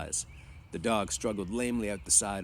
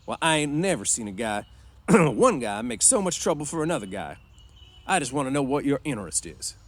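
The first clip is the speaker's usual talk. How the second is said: loud, higher-pitched, pressing questions; suspicious boss; 6 semitones higher, 7 dB louder than usual. A narrator's reading. Boss questioning George's stake in his friend, suspicious. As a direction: press him like a distrustful ranch boss.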